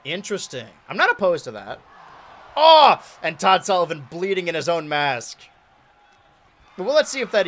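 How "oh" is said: loud, high burst of surprise; jolted by the sight; shocked oh. Real, not acted. Commentator sees a wrestler bleeding, cries out in shock. Sudden bloody moment.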